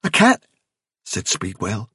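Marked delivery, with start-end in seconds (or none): 0.0-0.5 high, startled question; frightened guess, pitched right up; wide-eyed fright. A narrator's reading. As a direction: ask it in sudden alarm. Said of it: "wide-eyed".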